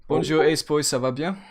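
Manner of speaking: greeting a driver in hammed-up French. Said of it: "Why are you talking French".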